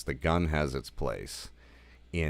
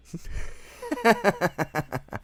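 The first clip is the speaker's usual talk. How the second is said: high, loud burst of laughter; real laugh; 4 dB louder than usual. Real, not acted. Vlogger cracking up while retelling the scene, high laugh.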